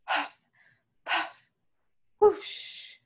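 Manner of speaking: breath puffs, then a high whoosh; blowing sound effects. A narrator's reading. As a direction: act out two puffs and a gust. Narrator imitates the wolf blowing.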